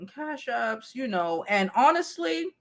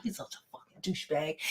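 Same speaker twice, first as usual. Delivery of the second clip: fast, much softer muttered insult; muttered aside; 8 semitones lower, 12 dB quieter, faster than usual. Retold or imitated. At the party, about the dude, a quick under-breath mutter.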